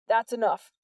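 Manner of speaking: firm, loud command. A narrator's reading. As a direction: stop them with authority. Sergeant stops quarrel.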